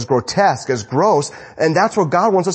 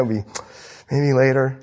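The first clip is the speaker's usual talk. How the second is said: usual speaking voice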